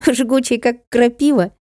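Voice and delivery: put-on high-pitched little boy's question; giggly child. A narrator's reading. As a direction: pipe up high like a tickled child. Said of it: "Интервьюер захихикал". (высоким детским голоском, озорно)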